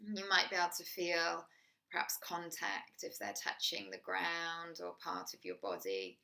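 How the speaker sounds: guided meditation. Acted. Teacher guiding a listener through a body scan, soft meditation voice. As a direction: ease the listener into the body, gently.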